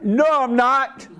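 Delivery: high, loud, drawn-out refusal; loud defiant woman. Retold or imitated. Bank customer refusing the manager's mask order, loud and indignant. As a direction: belt out an indignant refusal, imitating her. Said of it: "She was all loud".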